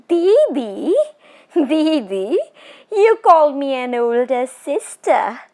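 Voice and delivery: high, eerie teasing spirit voice; a tall witch toying with her prey; ghost woman. Acted. Ghost woman teasing the frightened Bishan Singh, eerie and high.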